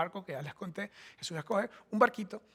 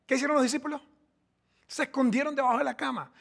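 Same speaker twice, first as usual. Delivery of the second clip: preaching at full force; 3 semitones higher, 4 dB louder than usual. Real, not acted. Preaching climax.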